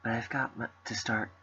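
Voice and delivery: quiet, gentle reading of game dialogue; soft character reading. Acted. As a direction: read the lines softly, in character. Reading visual novel dialogue.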